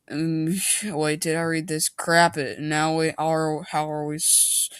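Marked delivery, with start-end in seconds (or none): none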